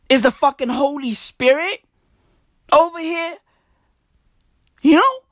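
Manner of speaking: high excited squeal. Real, not acted. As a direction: pitch it way up in excitement.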